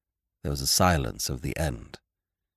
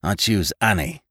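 strange, malicious; 3 dB louder than usual. A narrator's reading. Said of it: "His voice was strange and full of malice".

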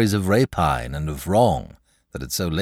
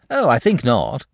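lazy; 5 semitones higher, 5 dB louder than usual. A narrator's reading. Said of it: "in his lazy way".

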